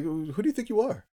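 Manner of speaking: high-pitched offended challenge; mock indignation. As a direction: act affronted at someone's nerve. Acting indignant car owner.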